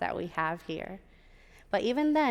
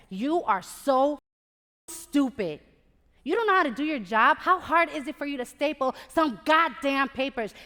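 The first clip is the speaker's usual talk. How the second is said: loud, higher-pitched mock tirade; upset tirade; 5 semitones higher, 7 dB louder than usual. Program director berating her in the studio, upset tirade. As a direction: act out an irate boss berating an employee. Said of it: "He was so upset".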